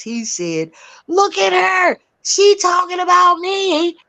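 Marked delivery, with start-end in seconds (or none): 1.1-4.0 high-pitched mocking accusation; mimicking his outraged finger-pointing; jeering imitation. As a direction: point her out in a pitched-up taunt. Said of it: "This is how he said it".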